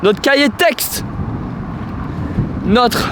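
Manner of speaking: high, excited bragging; hyped-up boast. Acted. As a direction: brag about the fans, pumped. Mock-hyped boast about subscribers.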